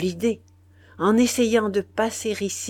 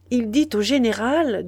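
ordinary delivery, nothing marked

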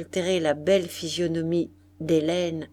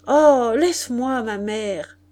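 higher, louder start that drops away; sulky protest; 3 semitones higher, 6 dB louder, slower than usual. A narrator's reading. Daughter in bed pushes her mother away, mildly sulky. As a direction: brush your mother off with a pout.

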